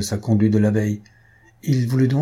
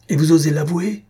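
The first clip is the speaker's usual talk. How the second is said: higher, louder outburst through clenched teeth; indignant cry, higher and louder; angry outcry; 6 semitones higher, 4 dB louder than usual. A narrator's reading. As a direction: spit out the accusation in fury. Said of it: "s'écria Clément, les dents serrées".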